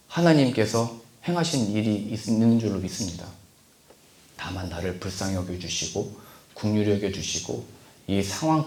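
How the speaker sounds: a quiet yielding prayer, the right way; humble prayer. Shown as a demonstration. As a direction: plead softly for mercy. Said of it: "따지듯이".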